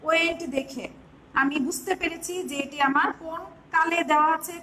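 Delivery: ordinary talk, nothing marked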